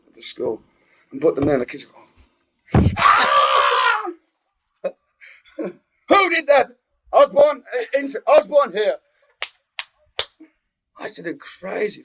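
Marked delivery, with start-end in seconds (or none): 3.0-4.1 imitated yelp of pain; mock scream. Kid grabbing hot pennies at school, mock scream. 5.6-8.9 high-pitched shouting schoolmaster; angry teacher. Acted. Teacher hunting the metalwork room prankster, angry teacher.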